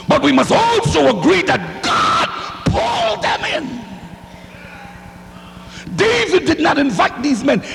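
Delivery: preaching at full force. Acted. Preacher shouting at offended listeners, full force. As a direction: shout the truth at the congregation.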